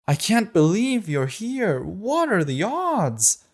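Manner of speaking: gushing with fake joy; girl gushing as she runs at Astrid; overjoyed girl. The girl's overjoyed greeting.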